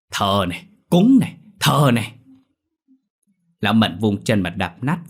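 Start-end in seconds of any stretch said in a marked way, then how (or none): 0.1-2.1 builds to a loud, high yell; furious shout. Acted. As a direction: smash each word out louder. Drunk man smashing the shrine.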